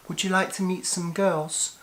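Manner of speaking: high-pitched imitation of a woman soliciting; woman's voice. Woman soliciting a passer-by in London, imitated in a high voice. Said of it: "when a woman approached me and asked".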